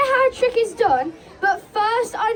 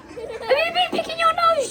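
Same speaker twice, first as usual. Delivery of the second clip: high, slow mock scandalised question; mock shocked high tease; 8 semitones higher, slower than usual. Magician teasing George about his green hands, high and slow.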